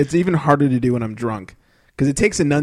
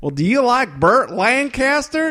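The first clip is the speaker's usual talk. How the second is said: high, slow, eager prompt; Huell Hauser question; 8 semitones higher, slower than usual. Retold or imitated. Host asking the employee, high and slow. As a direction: coax an answer with wide-eyed interest. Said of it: "my really bad Huell Hauser impression".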